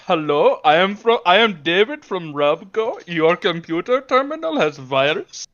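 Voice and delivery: mock Indian accent, scripted phone pitch; put-on Indian accent; Indian call center scammer. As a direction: play an Indian tech-support scammer. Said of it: "the Enclave Indian call center trying to scam".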